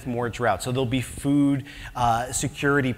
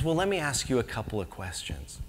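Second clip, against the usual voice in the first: gentle; 2 semitones lower, 7 dB quieter than usual. Retold or imitated. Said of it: "in his very gentle way".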